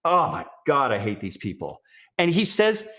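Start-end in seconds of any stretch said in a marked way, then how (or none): none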